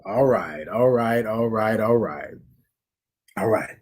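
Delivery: low, soft settling "all right"; soft after the breath. Real, not acted. Exhaling after breath hold.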